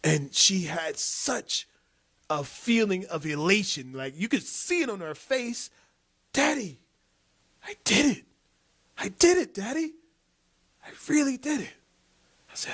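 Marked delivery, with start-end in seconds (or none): 6.3-11.7 put-on high, excited little girl's voice; a child bursting with pride, pitched up; elated child. Dad imitating his daughter's joy at riding alone, high and thrilled.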